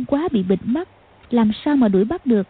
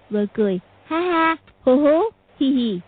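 imitating a creepy laugh; eerie mock laugh; 3 semitones higher than usual. A narrator's reading. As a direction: cackle like a dying man. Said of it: "bắt chước tiếng cười".

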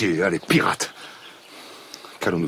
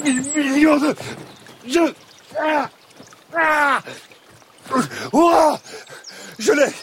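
high, loud strained outbursts; straining shout; 4 dB louder than usual. Acted. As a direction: yell while grabbing at something. Captain struggles to grab lizard.

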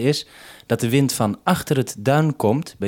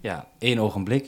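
usual speaking voice

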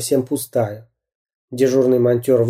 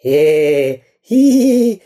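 high mock chuckle; squeaky cartoon bad-guy titter; gleeful villain giggle; 4 semitones higher, 4 dB louder, much slower than usual. A narrator's reading. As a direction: snigger with glee. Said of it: "радовался Дырка".

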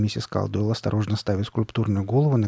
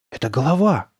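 higher, startled exclamation; astonished cry; 4 semitones higher, 3 dB louder than usual. A narrator's reading. Daughter spots the sculpture on the mantel, astonished cry. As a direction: cry out in amazement. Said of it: "вскрикнула от изумления".

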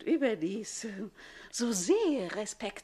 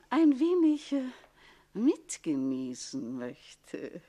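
ein punched, then mitgenießen slowly drawn out; insinuating drawl; 2 semitones higher, 3 dB louder, slower than usual. Acted. Older rival hinting at the count, slow insinuating mitgenießen. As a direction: lean hard on ein, stretch mitgenießen slowly.

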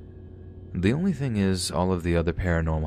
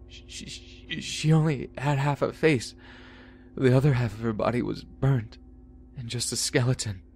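put-on high, shaky child telling horror; terrified child; 7 semitones higher than usual. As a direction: stammer it out in terror. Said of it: "through his loud sobs".

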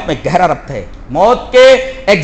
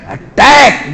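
punchy battle order; fervent command; 3 semitones higher, much slower than usual. Shown as a demonstration. Commander who must not sound cowardly, fervent order. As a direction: fire the word out with fervour. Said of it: "جوش کے اندر لفظ attack کو بولا جائے".

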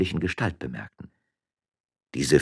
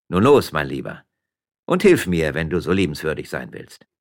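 mild sly cheer, louder at the start; slyly; 7 dB louder than usual. Acted. Miller calls policeman to the stable, slyly. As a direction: urge him on with a touch of mischief. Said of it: "sagte im Weggehen verschmitzt zu Tonuelo".

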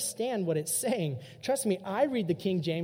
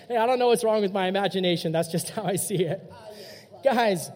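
ordinary talk

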